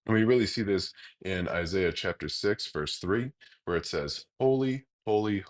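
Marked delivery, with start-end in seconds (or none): 4.4-5.4 "Holy, holy," each holy lifted high and loud; emphatic repetition. Shown as a demonstration. Reading Isaiah's triple holy to stress God's holiness, emphatic. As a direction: lean hard into every "holy". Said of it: "that is the most emphatic way to say something".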